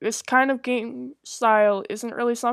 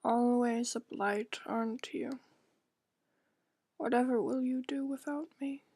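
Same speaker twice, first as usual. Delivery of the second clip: lightly voiced stern mother, cold and reproachful; 12 dB quieter than usual. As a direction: scold the girl gently but coldly, barely above speaking voice.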